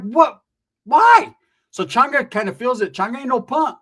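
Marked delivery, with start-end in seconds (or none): 0.0-1.3 high, loud disbelieving "What? Why?"; startled what why. Retold or imitated. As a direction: jump up in surprise. Reacting to Brianna's insult.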